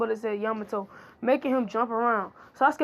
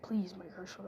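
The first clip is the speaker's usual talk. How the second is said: unvoiced aside whisper; 3 semitones lower, 12 dB quieter than usual. Yamato whispering to the boys to quiet her. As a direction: hush it under your breath.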